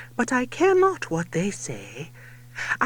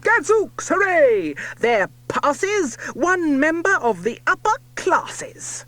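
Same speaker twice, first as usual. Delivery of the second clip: loud, higher cheering hero; triumphant shout; 5 semitones higher, 4 dB louder than usual. A narrator's reading. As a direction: cheer as the sword goes in.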